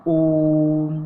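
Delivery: ओम held long, three beats, loud; prolated om. Shown as a demonstration. Teacher drawing out ओम for students. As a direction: stretch ओम over three matras. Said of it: "जैसे कि".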